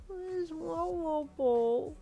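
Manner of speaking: put-on high, slow, sleepy little girl voice; a drowsy toddler asking for her toy; weary toddler. A narrator's reading. Sleepy toddler asking her mother for her ball, weary and high. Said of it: "she asked in a weary voice".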